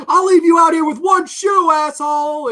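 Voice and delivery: loud, high imitation of a yelled threat; shouted movie quote. Fan quoting a favourite one-liner to the co-host, shouting. Quoting an angry line from the episode.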